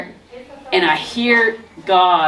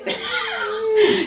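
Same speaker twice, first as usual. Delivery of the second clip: high, bright burst of laughter. Real, not acted. Grandmother buys a plane ticket.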